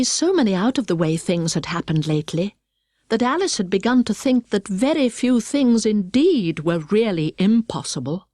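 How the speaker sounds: usual speaking voice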